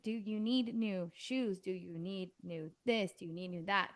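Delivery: drawn-out repeated questions; slowly, like a tired parent; slow nagging. Retold or imitated. Mom repeating the same question.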